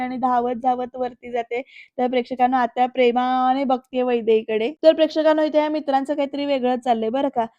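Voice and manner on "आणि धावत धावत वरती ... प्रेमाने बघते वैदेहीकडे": usual speaking voice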